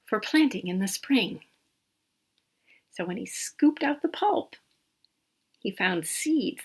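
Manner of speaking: soft whispered story voice, like a secret; hushed storytelling. Acted. Reading to a child at bedtime, whispering the secret part.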